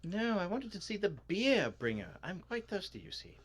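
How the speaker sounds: high, loud protest easing off; high-pitched comic character. Acted. Thirsty visitor corrects what they came to see, high comic voice.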